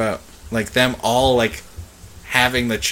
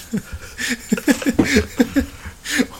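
high, helpless giggling; laughing; 14 semitones higher than usual. Real, not acted. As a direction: crack up at your friend's line. Cracks up at friend's delivery.

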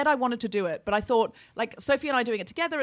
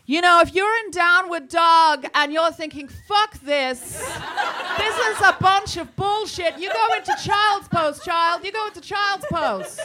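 higher, loud, laughing impression of the teacher; blunt yoga teacher; 7 semitones higher, 6 dB louder than usual. Mimicking Jessamine telling class to quit, loud and laughing. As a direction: belt out the swear like it's fine. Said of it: "Whereas Jessamine was like".

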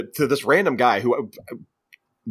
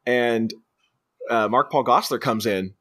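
ordinary talk

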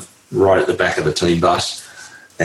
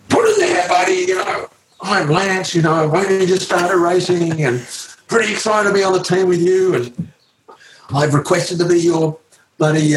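put-on young Lance Armstrong; 10 semitones higher than usual. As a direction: play the pushy, excited new teammate. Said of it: "and he said".

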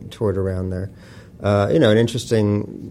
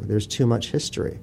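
usual speaking voice

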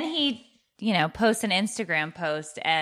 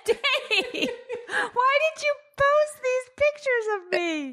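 high, teary wail through laughter; laugh-crying in touched protest; 11 semitones higher than usual. Real, not acted. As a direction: squeal it out, voice soaring with tears. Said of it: "she's crying".

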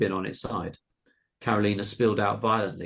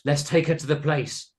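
raised, louder excited squeal; 6 semitones higher, 5 dB louder than usual. A narrator's reading. Boy urging the gang onward, squealed.

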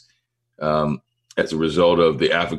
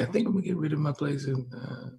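hushed confession; 9 semitones higher, 9 dB quieter than usual. Real, not acted. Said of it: "I have to whisper it and shit".

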